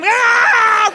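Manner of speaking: high, loud charging scream; dog attack scream. Retold or imitated. Dog runs at another guy.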